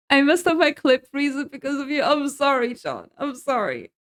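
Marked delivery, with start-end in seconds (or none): none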